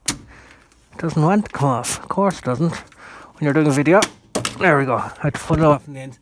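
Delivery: talking with a phone clenched in the teeth; phone held in teeth. Real, not acted. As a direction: speak around a phone held in the mouth. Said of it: "put the phone in my mouth a second".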